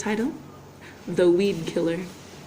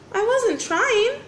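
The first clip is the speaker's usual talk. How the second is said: Oliver protesting; 11 semitones higher, slower than usual. A narrator's reading.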